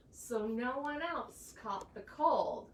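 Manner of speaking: usual speaking voice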